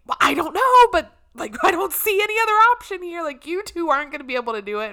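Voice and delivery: high, loud and rushed; flustered reply. Retold or imitated. Phyllis answers whether she can drive down, flustered. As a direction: rattle it off in mock agitation.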